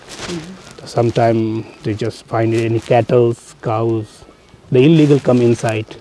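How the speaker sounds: ordinary talk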